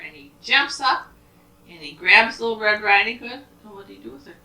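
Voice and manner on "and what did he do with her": ordinary delivery, nothing marked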